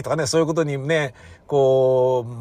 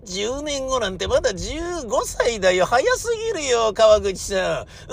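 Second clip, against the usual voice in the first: higher, mock protest; much higher, mildly exasperated; protesting parent; 8 semitones higher than usual. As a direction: push back at the pushy old man. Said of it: "とか".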